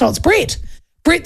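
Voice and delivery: ordinary delivery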